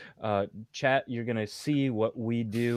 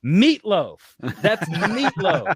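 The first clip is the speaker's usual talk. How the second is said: loud mock yell of an exasperated band teacher, the second one slower and laughing; imitated teacher yell; 7 semitones higher, 8 dB louder, slower than usual. Band teacher yelling at kids who played it wrong, loud mock yell. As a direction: bellow it like a fed-up band teacher. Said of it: "he would yell".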